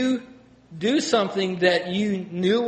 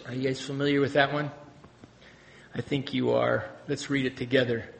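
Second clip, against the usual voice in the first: usual speaking voice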